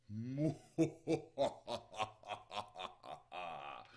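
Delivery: long, drawn-out villain cackle; staccato evil laugh, trailing off slowly; hammed-up goblin laughter; maniacal laugh. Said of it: "the same damned maniacal laughter".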